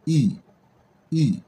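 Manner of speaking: I said as a long, falling "ee"; long ee sound. Shown as a demonstration. Teacher demonstrating the double-letter sound, I as long "ee". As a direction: hold I as a clear English "ee". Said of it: "ça donne le son de".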